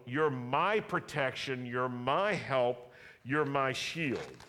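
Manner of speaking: slow, loud, ringing affirmation; fervent declaration. Shown as a demonstration. Preacher models the congregation's yes to God, slow and loud. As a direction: proclaim each phrase slowly with fervor.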